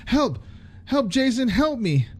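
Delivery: high desperate cries; calling for help. A narrator's reading. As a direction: scream for Jason, pitched high. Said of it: "she called for help".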